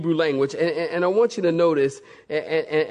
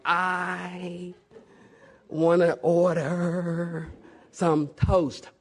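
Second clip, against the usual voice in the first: each word stretched out, with gaps between; slow, drawn-out ordering; 3 dB quieter, much slower than usual. Retold or imitated. Frustrated traveler to waiter with little English, very slowly.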